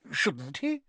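a touch of worry, rising question; anxious. Acted. Mr Weasley anxiously defending the oddly dressed wizard. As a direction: ask it a little too quickly. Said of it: "said Mister Weasley anxiously".

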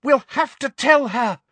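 high, loud desperate plea; frantic girl's cry; cracked shout. A narrator's reading. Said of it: "shouted Hermione in a cracked voice".

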